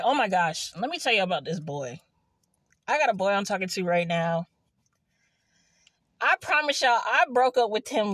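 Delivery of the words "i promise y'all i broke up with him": insistent. Real, not acted. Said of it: "insisting to viewers".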